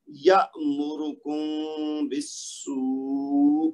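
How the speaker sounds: slow recitation of یامرکم بالسوء, the meem of کم held as a nasal hum into the ba; tajweed ikhfa. Shown as a demonstration. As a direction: draw out یامرکم بالسوء with a hummed ghunnah on the meem. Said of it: "مثالوں کی بات ہم کریں نا تو یہ ہے جیسے".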